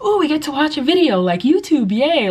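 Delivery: put-on playful excited-kid cheer; happy kids. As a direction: imitate children thrilled at a treat. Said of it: "extremely happy".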